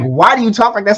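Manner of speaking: high, loud incredulous question; imitating a baffled customer; mock incredulous guy. Man at coffee shop asking barista, high and incredulous. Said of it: "He was like".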